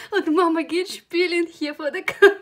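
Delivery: laughing loudly through the words; high, loud, laughing at herself. Real, not acted. (laut lachend)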